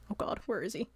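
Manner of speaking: ordinary talk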